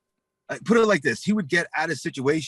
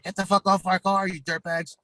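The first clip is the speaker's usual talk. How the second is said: high-pitched mock angry order; retold feisty confrontation; Tammy; 7 semitones higher than usual. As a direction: act out a woman telling off bikers. Tammy confronts bikers leaning on car.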